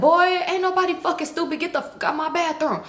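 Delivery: high-pitched mock scolding; angry scolding. Uncle rejects the stomach excuse, imitated angry scolding.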